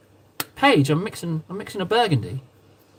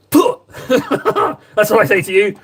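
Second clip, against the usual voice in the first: pig snort impression; 4 semitones higher, 3 dB louder, much slower than usual. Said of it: "that's not a snort, that's a pig impression".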